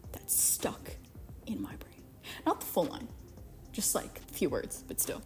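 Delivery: hushed whisper. Real, not acted. Telling viewers a line still haunts her, whispering. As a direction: drop to a secret whisper. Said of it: "whispers remembered line".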